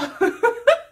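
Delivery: high, loud burst of laughter; sudden cackle cracking up mid-story. Real, not acted. Cracks up at her own recap.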